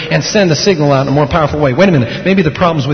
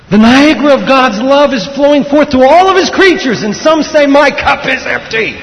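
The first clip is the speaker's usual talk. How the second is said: high, ringing sermon proclamation; full-force preaching; 11 semitones higher, 5 dB louder than usual. Real, not acted.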